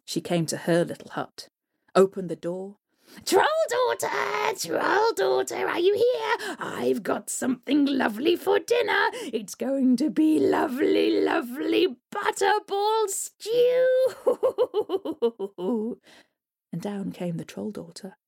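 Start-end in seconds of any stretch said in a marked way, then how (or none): 3.2-14.1 troll hag calling. Acted. Troll hag calls daughter. 14.2-15.9 high wicked hag cackle; gleeful cackle. Acted. Troll hag cackles over her catch, wicked cackle.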